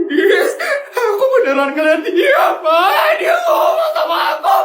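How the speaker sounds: high crying insistence; desperate sobbing cry; tearful pleading. Acted. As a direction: beg him to believe you.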